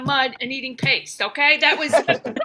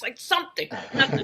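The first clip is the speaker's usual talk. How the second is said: ordinary talk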